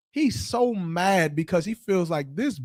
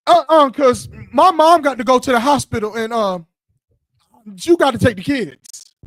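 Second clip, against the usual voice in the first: higher, louder woman's demand; mock bossy woman; 6 semitones higher, 6 dB louder than usual. Other woman refusing to let the man leave, higher and louder. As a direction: hit him with it like the other woman. Woman voiced in acted quarrel.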